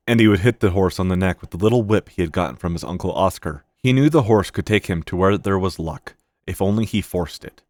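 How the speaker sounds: ordinary delivery, nothing marked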